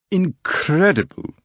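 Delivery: high, slow drawn-out Incredible; exclaiming. A narrator's reading. Stranger marvelling at the poet, slow exclaim. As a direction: savor the word with amazement. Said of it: "exclaimed the uninvited guest".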